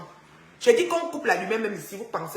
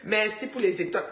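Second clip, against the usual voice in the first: laughing thanks. Real, not acted. Host laughs while thanking viewers for stars.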